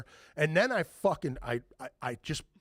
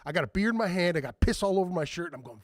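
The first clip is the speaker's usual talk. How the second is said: usual speaking voice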